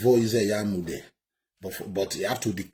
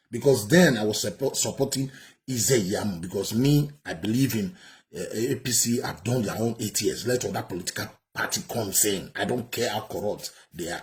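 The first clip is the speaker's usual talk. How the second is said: ordinary talk, nothing marked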